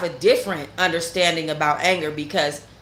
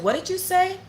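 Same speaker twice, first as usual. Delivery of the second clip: retold challenge; 12 semitones higher than usual. Confronting him a second time.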